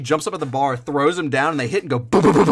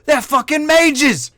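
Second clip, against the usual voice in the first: loud, much higher, slow panicked yell; alarmed shout; 6 dB louder, slower than usual. Acted. Knights warn each other, high slow alarmed yell. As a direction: bellow the warning across the tavern.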